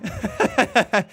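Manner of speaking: laughing through the words; giggling, slow and amused. Real, not acted. Laughing at own stretch.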